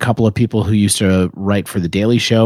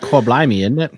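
mock cockney; 3 semitones higher than usual.

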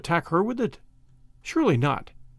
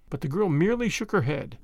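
ordinary delivery

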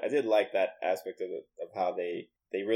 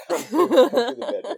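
high loud teasing taunt; taunting killer voice; 14 semitones higher, 8 dB louder than usual. Pretend. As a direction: lure the intruder in mockingly.

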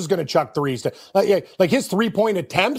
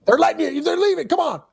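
cheering on; 10 semitones higher than usual. Pretend. Cheering player on.

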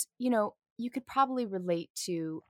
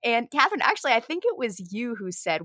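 ordinary talk, nothing marked

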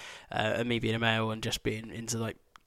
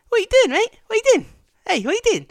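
very high, loud squawking policeman; mock angry copper; 9 dB louder, faster than usual. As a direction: squawk it like a furious cop. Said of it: "and he's like".

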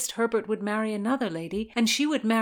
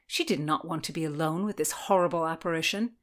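ordinary delivery, nothing marked

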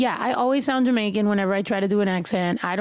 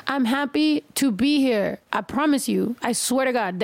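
ordinary delivery

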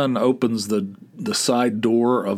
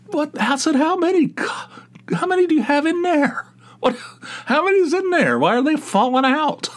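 much higher, incredulous What, how many; disbelieving brother who keeps dropping mugs, high and shocked; shocked disbelief. Retold or imitated. Shocked at mugs still falling.

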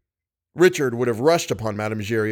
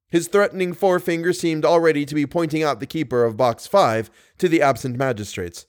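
plain reading voice

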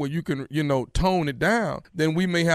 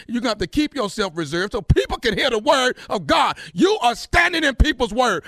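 angry shouting; 9 semitones higher than usual. Acted. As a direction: yell it at her, furious. Heated phone argument, raised voice.

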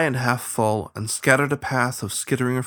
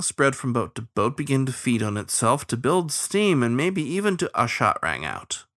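usual speaking voice

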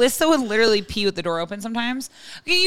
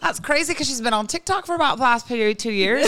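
lilting put-on Irish brogue; Irish accent; 2 semitones higher than usual. Host comments on listener email in dad's accent, Irish accent. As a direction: keep up the mock Irish accent. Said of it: "but we would say wee situation".

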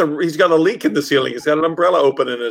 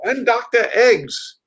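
put-on slow, higher mad-doctor accent on Eggs; Strangelove accent; 8 semitones higher, much slower than usual. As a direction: announce yourself grandly, slowly. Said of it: "like Doctor Strangelove".

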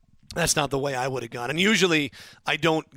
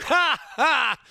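burst of laughter; 9 semitones higher than usual. Real, not acted. Host laughs at caller's prediction.

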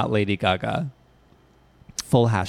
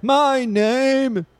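mock singer voice; 12 semitones higher than usual. Mocking the song sample.

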